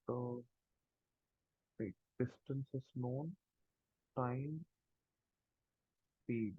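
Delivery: faint, far from the mic; too soft to follow; barely audible. Real, not acted. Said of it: "can you speak a little bit loud".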